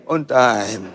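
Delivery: mournful sigh of "On time"; full of grief. Shown as a demonstration. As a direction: say it as if it hurts. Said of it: "Ngomong on time sambil penuh kepedihan".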